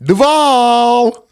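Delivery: high, held-out hometown holler; drawn-out Duval call. Shown as a demonstration. Host showing how he used to say Duval, drawn-out call. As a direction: stretch DUVAL long and high, like a proud call. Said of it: "Damn, I used to sound like".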